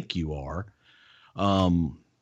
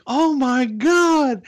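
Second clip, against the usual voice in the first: high, slow, loud gasp of disbelief; mock shock; 5 dB louder, much slower than usual. The crowd reacting to Freddie, mock shocked. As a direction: stretch out the shocked exclamation. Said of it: "and it's like".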